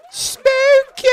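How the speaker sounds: very high, loud mock-spooky cry; spooky squeal. Vlogger squealing spooky at his viewers. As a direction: squeal it like a cartoon ghost.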